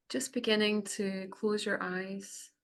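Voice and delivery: unhurried meditation voice with long pauses; guided meditation. Acted. Facilitator guiding the group to close their eyes, guided meditation. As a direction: ease them gently inward, phrase by phrase. Leads a cacao ceremony meditation.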